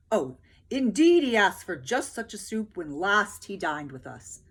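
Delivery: loud, grand soldier voice; soldiers boasting. A narrator's reading. Soldiers boasting of the king to peasants, loud. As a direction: brag about the king grandly. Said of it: "sighed the soldiers".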